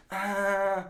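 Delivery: long, high nasal-vowel whine; drawn-out reluctant whine, held high; whiny groan. Shown as a demonstration. Teacher mimicking someone who doesn't want to do something, whining. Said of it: "kinda like when you're whining".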